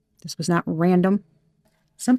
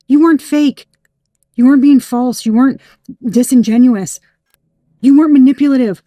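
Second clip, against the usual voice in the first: loud, higher, punching each denial; raised, insistent voice; emphatic insistence; 4 semitones higher, 7 dB louder than usual. Real, not acted. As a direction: vindicate them forcefully, hammering every phrase. Insistent vindication of the listener.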